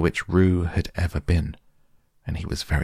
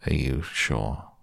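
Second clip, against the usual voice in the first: gloomy donkey; 4 semitones lower, 4 dB quieter, slower than usual. Acted. Eeyore voiced gloomily.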